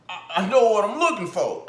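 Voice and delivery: high loud mocking voice; mock Broner quote. Mocking the boxer's brag, high and loud. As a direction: ham up the boxer's boast. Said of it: "And then you said".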